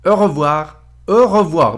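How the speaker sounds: "Au revoir" in careful French, a rounded closed "au" and a throaty r in "revoir"; French pronunciation demo. Shown as a demonstration. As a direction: model "Au revoir" slowly for learners, lips rounded on "au". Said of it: "au revoir رو برم اینجا بنویسم".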